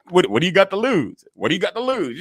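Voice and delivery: high, loud brash Trump voice; Trump impression. Podcaster redoes the Trump quote minus the curse, loud and brash. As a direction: toss it off like a brash showman. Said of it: "Trump is like".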